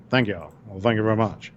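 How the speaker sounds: lightly voiced rock-and-roll king's thanks; a hint of the singer's famous thank-you. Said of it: "[name] is a huge [name] fan, so that's why I did that".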